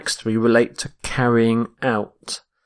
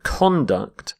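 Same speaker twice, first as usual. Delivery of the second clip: "conduct" as CON-duct, first syllable stressed; noun stress. Shown as a demonstration. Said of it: "when we're using the noun".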